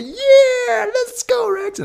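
very high, excited cheer. Retold or imitated. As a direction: whoop like a winning team. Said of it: "他们就".

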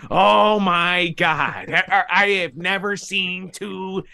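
high, slow announcer Chucky voice; Chucky impression. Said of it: "I like doing a Chucky impression".

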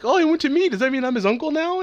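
high, loud cartoon voice; imitated excited character. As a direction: play a character reacting in mock amazement. Acting out character's bewildered reaction.

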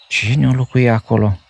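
wondering. A narrator's reading. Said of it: "se întrebă el mirat".

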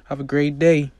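loud, high sing-song goodbye; mother calling goodbye loudly as the bus arrives; cheery mom. Acted. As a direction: wave her daughter off to the bus. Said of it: "Tiffany says".

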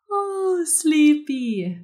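put-on high sing-song little girl voice; a kid pretending to be sleepy; fake sleepy child. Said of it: "with that whole tone".

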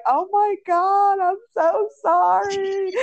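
slow, high, choked-up sorry; tearful sympathy. Retold or imitated. Hearing her friend's sad voice clip, slow tearful sympathy. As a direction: sob it out in sympathy. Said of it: "I'm over here like".

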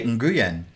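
"Nguyen" with a hard g, n-GOO-yen; spell-it-out English "Nguyen"; anglicized mispronunciation. Shown as a demonstration. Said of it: "That's so wrong".

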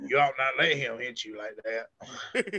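low, slow, soft mumble; Sling Blade voice. Retold or imitated. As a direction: drop low and drawl it softly. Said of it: "And Sling Blade".

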